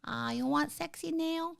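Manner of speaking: a hint of a teasing Thai accent. Wife teases fans in a light Thai accent. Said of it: "asking me to do my Thai accent".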